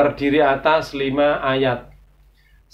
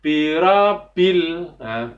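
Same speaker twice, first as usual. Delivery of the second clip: ordinary delivery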